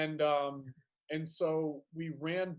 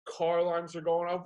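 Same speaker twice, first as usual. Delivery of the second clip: ordinary talk, nothing marked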